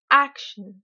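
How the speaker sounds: "action" in English, AK punched very loud; teacher's over-stressed "action", loud first syllable; exaggerated English stress. Shown as a demonstration. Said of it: "je vais délibérément exagérer".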